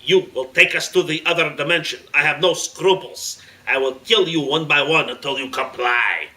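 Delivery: put-on loud terrorist leader with a foreign accent; forceful, threatening villain command; menacing order. A narrator's reading. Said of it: "pointed the iron sights ... and ordered".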